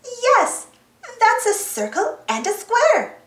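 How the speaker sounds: high-pitched, eager confirmation; excited. A narrator's reading. Dora confirms the shapes to Boots, excitedly. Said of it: "Dora said excitedly".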